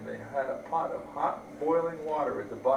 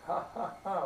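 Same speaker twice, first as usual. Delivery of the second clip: mock laugh; 4 semitones higher than usual. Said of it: "laughed the first little pig".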